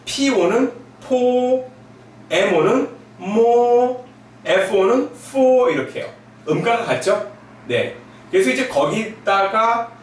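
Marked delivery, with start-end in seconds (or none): 1.0-1.7 뽀 held high and level; first tone. Shown as a demonstration. Teacher reading bo in the first tone, high and level. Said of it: "일성으로 읽을게요". 3.2-4.0 뽀 held high and level; first tone. Shown as a demonstration. Teacher reading bo in the first tone, high and level. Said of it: "일성으로 읽을게요". 5.3-5.7 뽀 held high and level; first tone. Shown as a demonstration. Teacher reading bo in the first tone, high and level. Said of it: "일성으로 읽을게요".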